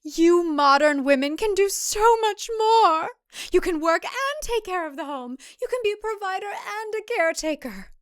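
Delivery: high, earnest mother-in-law lecturing; stern matron voice; preachy older woman. Acted. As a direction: lay down the old traditional values. Deborah pleading her case.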